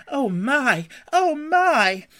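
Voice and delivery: loud, high cries of wonder, climbing each time; awestruck gasp. A narrator's reading. Mole overcome at what Rat has brought, gasping loudly. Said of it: "still gasping".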